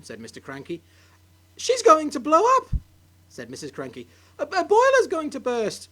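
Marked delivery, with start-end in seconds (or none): 1.6-2.7 high, loud alarmed cry; panicked wife. Acted. Wife panics at steaming grandma. 4.4-5.9 high, loud alarmed cry; panicked wife. Acted.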